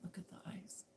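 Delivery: voiceless whisper. Retold or imitated. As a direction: breathe it almost silently. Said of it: "And I go".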